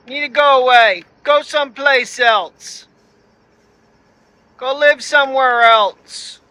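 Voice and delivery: loud, high commanding shout; shouting at bears. Real, not acted. Man yelling at bears in his yard to leave, loud and high.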